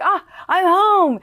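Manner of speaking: high, loud, drawn-out joyful exclamation; retold elation. Reporter recalls spotting the anchor's C B C poster, elated. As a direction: relive it with a long, soaring cry. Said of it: "It felt so great".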